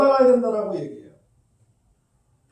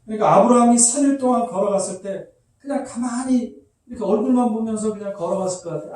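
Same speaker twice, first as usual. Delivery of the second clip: ordinary talk, nothing marked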